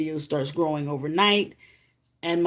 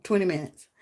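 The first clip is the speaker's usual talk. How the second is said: normal talking voice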